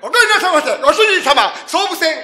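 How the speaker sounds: shouted greeting. Acted. Loud greeting in game.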